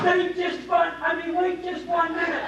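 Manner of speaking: loud, high shout after someone leaving; calling out. Acted. Son shouting after Gunther as he leaves, loud. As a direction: yell after him to stop.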